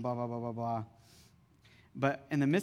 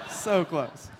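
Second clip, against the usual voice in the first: a louder, higher second go at it; louder mock despair; 4 semitones higher, 6 dB louder than usual. Pastor retelling the near miss to the church, loud and playful. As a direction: punch it up as playful exasperation. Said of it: "We're like".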